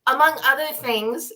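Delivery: usual speaking voice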